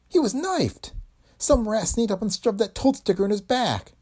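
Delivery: very high shaken voice; horrified. Acted. Dame aghast at how the boss was killed. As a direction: shake with horror.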